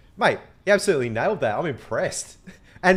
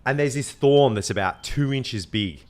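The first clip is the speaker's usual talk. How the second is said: usual speaking voice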